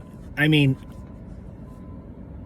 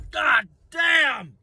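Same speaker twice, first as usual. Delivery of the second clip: hoarse shout; 9 semitones higher than usual. Real, not acted. Shouting after the rant.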